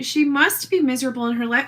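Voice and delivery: normal talking voice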